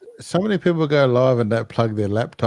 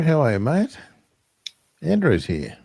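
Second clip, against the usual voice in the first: normal talking voice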